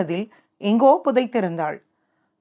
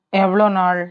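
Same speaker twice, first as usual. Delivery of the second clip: understated anger; 3 semitones lower than usual. A narrator's reading. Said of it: "Arjun அதே கோபத்தோடு கேட்க".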